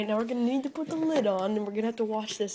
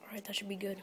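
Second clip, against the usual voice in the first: whispering; 9 dB quieter, faster than usual. Real, not acted. After the blender.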